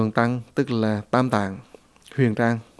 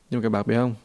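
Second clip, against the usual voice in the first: usual speaking voice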